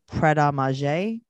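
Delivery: Pret a Manger as "pret ah mah-ZHAY"; French-style pronunciation. Shown as a demonstration. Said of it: "we just call it".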